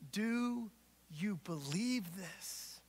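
slow, hushed, intimate ask; Jesus quietly asking Martha; soft searching question. Acted. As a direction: ask it gently, almost a whisper. Jesus asks Martha gently.

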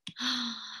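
soft audible intake of breath; storyteller's gasp. Acted. Storyteller awaiting the snowman's answer, soft gasp. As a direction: breathe in with mock suspense.

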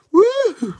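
high whooping yell; excited whoop. Real, not acted. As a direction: let out a big whoop. Whoop of hype.

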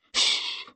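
soft, voiceless shush. A narrator's reading. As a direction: hush them with a quiet hiss of air. He silences her.

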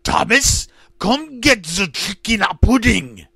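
put-on high, loud German-accented scream. Hitler-sounding man calls Thomas, screamed German accent. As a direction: bellow it in a thick German accent. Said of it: "he screamed, his thick German accent".

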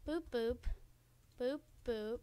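normal talking voice

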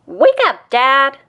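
high, bright call to a sleeping dad; eager wake-up call. Acted. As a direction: chirp it out loud to rouse him. Child wakes sleeping dad.

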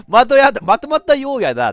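mock your own Kansai slip, giggling; Kansai dialect echo. Streamer repeats own Kansai slip, laughing. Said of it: "なんで今ちょっと関西弁で言おうとしてしまったかな".

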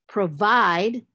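PROVIDE drawn out, stress on VIDE; stress on second syllable. Shown as a demonstration. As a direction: lean long and loud on the base of provide. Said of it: "it's on the base".

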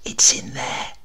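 hushed whisper while pointing. A narrator's reading. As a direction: breathe it so no one hears. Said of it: "pointing at the canopy".

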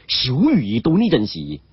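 ordinary delivery

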